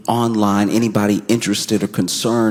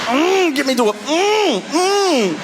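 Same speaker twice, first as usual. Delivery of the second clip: very high, strained, groaning plea; strained injured plea; 13 semitones higher than usual. Retold or imitated. Crashed leader begs to be taken to hospital, strained high groans. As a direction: squeeze it out like a crushed man. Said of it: "he was like".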